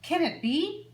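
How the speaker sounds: high, loud astonished question; amazed. A narrator's reading. Reader marvelling at the flea for the children, high and amazed. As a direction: sound truly astonished. Dramatic wonder in story.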